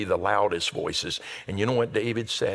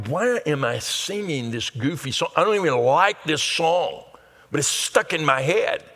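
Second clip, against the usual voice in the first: higher-pitched, baffled self-complaint; acted annoyance, pitched up; mock exasperation; 5 semitones higher than usual. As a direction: grumble in comic disbelief at yourself. Mock complaint about stuck song.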